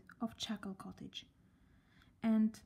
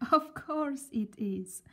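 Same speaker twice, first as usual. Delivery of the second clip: slow, higher giggly reply; giggling; 5 semitones higher, 5 dB louder, slower than usual. A narrator's reading. Miss Giggles giggling, her giggle back. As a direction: giggle through the words. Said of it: "she giggled".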